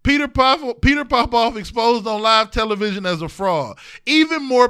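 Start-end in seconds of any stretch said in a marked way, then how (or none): none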